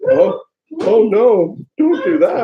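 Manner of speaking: hammed-up shocked cry, high and loud; mock alarm. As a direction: play it up like a big scare.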